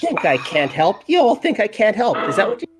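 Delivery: pixie voice. Acted. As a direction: gloat in a tiny voice. Pixie Farum gloating.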